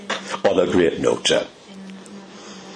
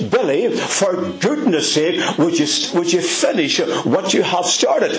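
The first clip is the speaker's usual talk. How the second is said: higher, exasperated nagging; wife Ethel scolding Billy about his unfinished jobs; 8 semitones higher than usual. Retold or imitated. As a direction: plead with a husband who never finishes. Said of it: "Ethel says".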